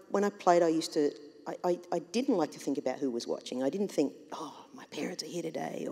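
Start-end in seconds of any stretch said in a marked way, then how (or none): 4.3-5.9 hushed whisper to herself; whispered thought. Retold or imitated. Athlete voicing a nervous inner thought, whispered. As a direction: breathe it like a private worry.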